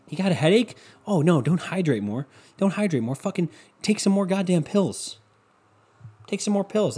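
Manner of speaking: high, mocking, egging you on; sarcastic mock voice. Mocking people who pop pills, high sarcastic voice. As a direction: sneer at pill takers sarcastically. Sarcastic rant voicing pill mindset.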